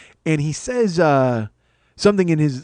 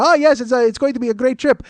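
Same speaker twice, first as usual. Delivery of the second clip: higher, louder, eager small talk; chatty seatmate; 9 semitones higher, 5 dB louder than usual. Retold or imitated. Passenger making small talk to comedian, chatty seatmate. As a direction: put on a high-pitched chatterbox passenger.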